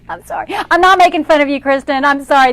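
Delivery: playful protest through laughter; laughing apology. Real, not acted. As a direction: apologise while still cracking up. Apologising while laughing at mistake.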